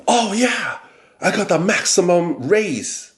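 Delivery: high, smug imitation of the coworker bragging; mock gloating coworker. Coworker bragging about his raise, mock smug high voice. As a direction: ham up the clueless coworker crowing over his raise.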